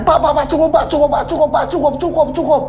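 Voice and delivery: high frantic begging; desperate plea to stop; teacher begs father to stop hitting Hendy; pleading teacher. Acted. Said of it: "langsung menangis".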